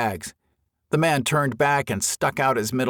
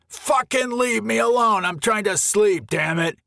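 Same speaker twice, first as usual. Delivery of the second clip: angry shout; 10 semitones higher than usual. Acted. Woken camper shouts at Greg.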